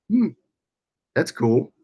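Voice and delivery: ordinary delivery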